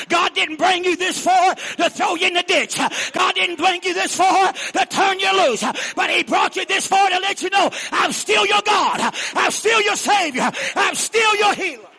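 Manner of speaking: rising, shouted preacher's cadence, each line hammered; preaching at full force. Acted. Pastor shouting assurance to the congregation, full-force preaching.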